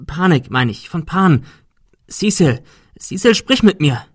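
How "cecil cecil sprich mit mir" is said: higher, pleading call of a name; urgent call. Acted. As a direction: call him back to you. Ben calling injured Cecil.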